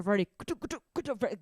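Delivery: choppy rapid-fire nonsense syllables; mock a language as angry chatter; mock angry dialect. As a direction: imitate a harsh, staccato-sounding language. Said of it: "you sound like you're so angry".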